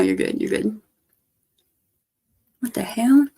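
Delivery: ordinary delivery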